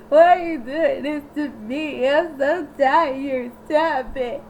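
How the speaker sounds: mock whimpering through laughter; laughing whine. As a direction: whine like a tired coward, laughing. Steve whining on hamster wheel.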